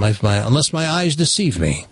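usual speaking voice

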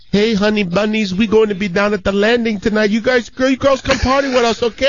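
high, bright call out the car window; flirty pickup line. Retold or imitated. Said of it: "I'll never forget what he goes".

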